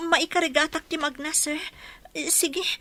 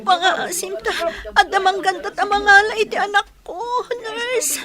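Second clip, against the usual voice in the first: sobbing, loud and high, begging; crying plea; 5 semitones higher, 7 dB louder than usual. Acted. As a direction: plead through tears in pain. Mother in labor crying pleading. (agsangsangit nga agpakpakaasi)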